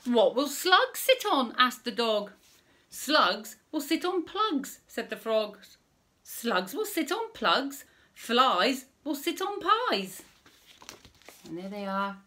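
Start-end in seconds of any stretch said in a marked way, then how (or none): 0.0-10.2 lively, high storybook voice, a little slow; reading to children. Acted. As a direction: read it out big for little listeners.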